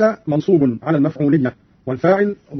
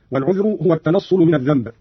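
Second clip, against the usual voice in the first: normal talking voice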